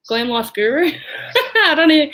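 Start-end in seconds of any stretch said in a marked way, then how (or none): 0.9-1.5 short breathy laugh. Real, not acted. Laughing at own pronunciation. 1.5-2.1 laughing. Real, not acted. Giggling after mispronouncing.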